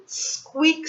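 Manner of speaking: squeaky sound word. Acted. Reading the sound word aloud.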